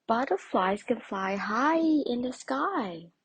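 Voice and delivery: lightly voiced friendly bird; friendly robin. A narrator's reading. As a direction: ask it kindly, drawing it out. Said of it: "asked a friendly robin".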